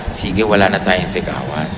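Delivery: low, soft agreement; gentle promise. Acted. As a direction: say it softly as a calming pledge. Models a promise for couples to repeat.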